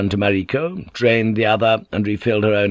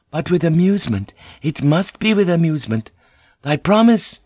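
higher voice rising on the final question; Mariko pressing; 8 semitones higher than usual. A narrator's reading. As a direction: press him for his promise.

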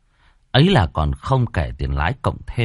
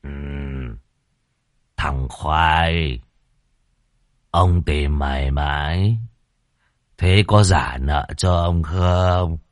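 lower, slow, menacing landlord voice; stern rich old man; 3 semitones lower, much slower than usual. Acted. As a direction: demand the debt, slow and threatening. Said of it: "lão trợn mắt quát". (lão bá hộ chậm rãi đe dọa)

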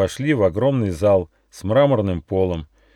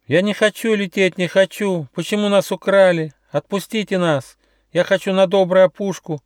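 shouting little hedgehog; 12 semitones higher, 3 dB louder than usual. A narrator's reading. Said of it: "кричал ежонок Почемучка".

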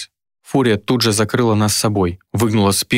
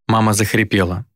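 usual speaking voice

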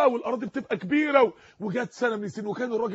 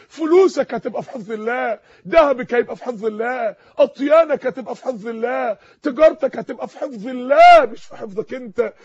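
louder each line to a peak; building refrain; 8 dB louder than usual. Real, not acted. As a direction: drive the refrain home, climbing. Rising refrain to climax.

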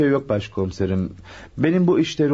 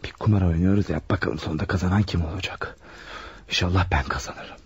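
muttered aside; 7 semitones lower, 5 dB quieter than usual. Acted. Alone, waiting, talking to himself.

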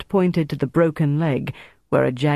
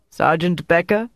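mildly raised, calling out; 2 semitones higher than usual. A narrator's reading. Major calls to his sergeant next door, raised voice. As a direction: call to a subordinate. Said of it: "Dieter raised his voice".

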